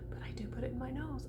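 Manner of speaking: a breathy whispered aside; whispered confession about her nose. Real, not acted. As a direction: drop to a secret whisper. Aside to viewers.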